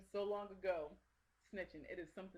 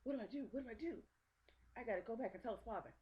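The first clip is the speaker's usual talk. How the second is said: higher-pitched fretting cartoon cricket; Jiminy Cricket; 5 semitones higher than usual. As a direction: imitate a cricket in a dither. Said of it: "and he says".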